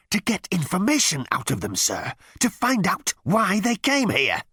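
high, exasperated underling; frustrated officer. A narrator's reading. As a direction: plead upward in frustration. Frustrated Number Two answers captain.

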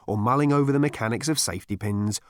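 ordinary delivery, nothing marked

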